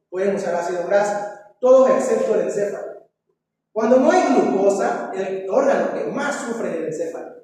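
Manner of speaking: ordinary talk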